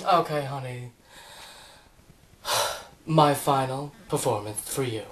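low, soft voice with a sigh; hushed, low introduction; subdued announcement. Acted. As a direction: announce it quietly, sighing. Resigned introduction to last song.

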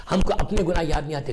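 ordinary talk

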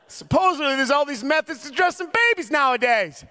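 high-pitched comic ranting; mock exasperation. Dad ranting about baby-dressing methods, mock exasperation. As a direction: scoff high and loudly in mock disbelief.

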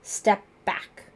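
loud, angry command. A narrator's reading. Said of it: "raising his voice angrily".

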